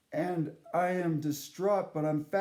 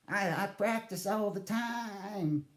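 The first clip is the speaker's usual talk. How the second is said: slow granny voice; slower than usual. Retold or imitated. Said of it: "what my Nana sounds like".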